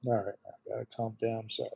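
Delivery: hushed, broken by long silences; choked up, trying to calm down while apologizing; really emotional. Real, not acted. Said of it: "I get really emotional".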